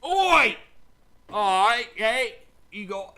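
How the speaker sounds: loud, high Cockney pub shout; brash, hammed-up Cockney holler; Cockney accent. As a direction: open a toast as a rowdy Cockney. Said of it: "I'll do it in Cockney accent".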